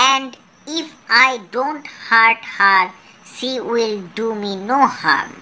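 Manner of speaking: very slow, word-by-word English rhyme; slow rhyme reading. Shown as a demonstration. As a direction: read the poem slowly for children to repeat. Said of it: "Listen and say".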